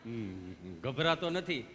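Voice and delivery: very low, slow patient reply; bashful, slow denial; smitten patient. Acted. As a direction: drag it out low, charmed by the nurse. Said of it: "તમે કેટલું પ્રેમથી પૂછો છો".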